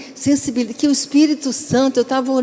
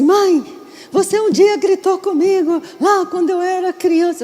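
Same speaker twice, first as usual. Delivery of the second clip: complaining daughter; 7 semitones higher than usual. Retold or imitated. Daughter reproaching her mother over past yelling, mock complaint. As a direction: play a child calling mom to account.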